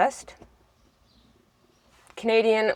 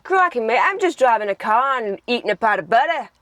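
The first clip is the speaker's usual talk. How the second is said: hammed-up, lively Australian; Australian accent; 3 semitones higher than usual. Shown as a demonstration. Sibling making up a silly sentence, exaggerated Australian. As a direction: stretch the vowels in a mock Australian accent. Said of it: "The Australian".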